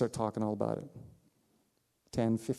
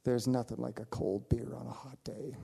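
softer, whispered joke; conspiratorial whisper; 6 dB quieter than usual. Retold or imitated. Old man jokes to pastor about beer, whispered. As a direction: let him in on a secret. Old man's joking confession.

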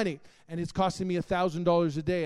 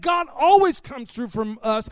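emphatic repeat; 6 semitones higher, 9 dB louder than usual. Retold or imitated. Repeating wife's declaration.

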